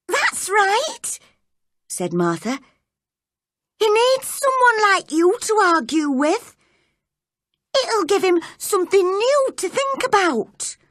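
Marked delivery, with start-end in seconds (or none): 0.1-1.2 put-on high-pitched servant girl; eager, worked-up maid; agitated maid. A narrator's reading. Frantic maid urging Mary on. 3.8-10.8 put-on high, urgent servant girl; worked-up maid, pitched well up; agitated maid. A narrator's reading. Frantic maid urging Mary on.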